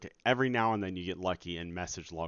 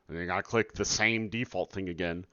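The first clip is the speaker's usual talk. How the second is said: ordinary talk, nothing marked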